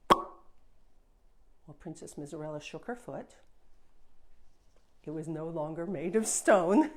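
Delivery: ordinary delivery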